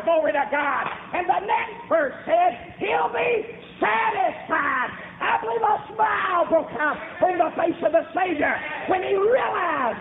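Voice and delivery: high, driving pulpit cry; full-force preaching. Real, not acted. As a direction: preach it high and stretch the key words.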